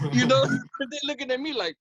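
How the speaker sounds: normal talking voice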